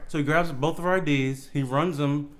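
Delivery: ordinary talk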